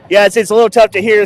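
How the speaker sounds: ordinary talk, nothing marked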